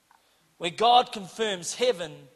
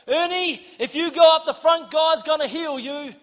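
higher, insistent promise; bold urging; 7 semitones higher, 5 dB louder than usual. Retold or imitated. Telling Ernie to go up for healing, bold urging. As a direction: urge him boldly up front.

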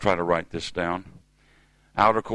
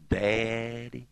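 plaintive little cry; 3 semitones higher, 3 dB quieter than usual. Retold or imitated. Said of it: "a plaintive little cry".

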